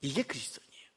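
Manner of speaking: low, breath-only whisper; whispered repeat. Real, not acted. Preacher repeating to congregation who Christians are, whispered. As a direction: whisper the line for weight.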